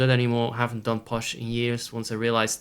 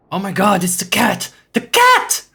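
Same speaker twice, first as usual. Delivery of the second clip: excited squeal; 8 semitones higher, 9 dB louder than usual. Real, not acted. Suddenly spots the cat.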